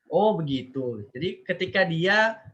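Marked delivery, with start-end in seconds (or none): none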